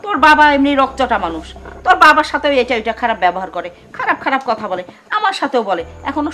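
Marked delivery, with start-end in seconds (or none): none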